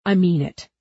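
stern parental warning; firm. A narrator's reading. Huck insisting on the gun, firm. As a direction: press it home firmly. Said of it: "Huck made his voice firm like an angry parent".

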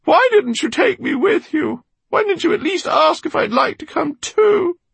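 high, loud wailing complaint; high-pitched, wounded outcry; anguished reproach. A narrator's reading. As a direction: plead with someone who has gone. Eleanor's anguished reproach to absent Meggie.

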